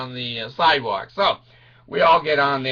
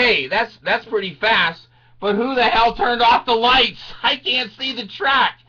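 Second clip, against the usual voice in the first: raised, loud comic alarm; alarmed complaint; 8 semitones higher, 4 dB louder than usual. Acted. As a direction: protest loudly from a ride in the dark. Acted alarm on the ride.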